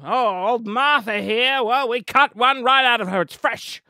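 lightly voiced pushy stallholder; market hawker. Seller pitching an organ to a passerby, hint of a hawker. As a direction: sell it slow like a dodgy trader.

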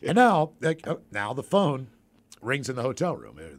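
usual speaking voice

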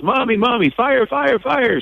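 put-on high, urgent little kid's voice; imitating child. Boy warning his mom about fire, imitated high. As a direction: call mommy like a scared kid. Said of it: "He goes".

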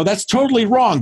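bold contradiction. Retold or imitated. Acted bold reply to client.

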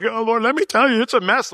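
high, loud, hammed-up unloading; mock overwhelmed complaint. Will pouring out troubles to the Lord, high and loud mock whine. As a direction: whine it out to God, overplayed. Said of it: "I'm like".

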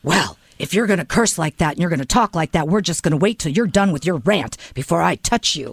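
low, stern officer voice; lightly voiced officer, low and measured; Officer Fry. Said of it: "And then Officer Fry says".

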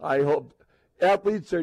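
normal talking voice

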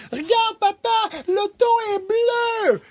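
put-on high, slow child voice announcing a discovery; shouting five-year-old. Dad imitates his five-year-old shouting at a car. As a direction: kid yelling each word like a big discovery. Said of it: "les enfants crient chacun de leurs mots".

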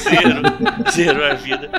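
laughing through the words. Real, not acted. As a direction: say it while cracking up. Joking reply while laughing.

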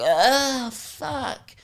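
put-upon private school brat, high drawn-out groans; bratty whining complaint. Retold or imitated. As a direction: groan like every tiny effort is torture. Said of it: "she'd be like".

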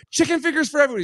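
high-pitched call across the room; rowdy ordering. Retold or imitated. Storyteller imitating his friend ordering from the waiter, high calling-out. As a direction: imitate a loudmouth hailing the waiter.

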